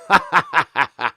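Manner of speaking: a real burst of loud laughter. Real, not acted. Streamer laughing at the border news, loud laugh. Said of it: "I shouldn't laugh about that".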